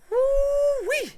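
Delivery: very high gleeful whoop; excited whoop. Real, not acted. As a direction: whoop with delight.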